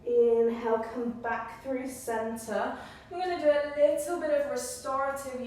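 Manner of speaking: slow, drawn-out yoga teacher voice; unhurried cueing with long pauses; yoga guidance. Real, not acted. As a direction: guide them through each breath, stretching the key words. Guides a yoga breathing practice.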